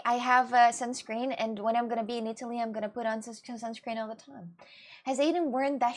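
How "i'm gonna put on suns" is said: ordinary delivery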